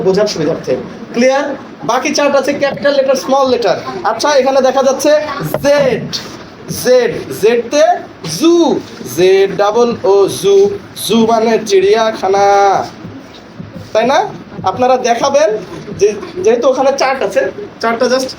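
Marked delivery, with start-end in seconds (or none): 5.6-12.5 slow, drawn-out জেড, জু and চিড়িয়াখানা; chart-reading demo. Shown as a demonstration. Trainer models a letter chart for pupils, slow and stretched. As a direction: chant out জেড double ও জু slowly, each piece held.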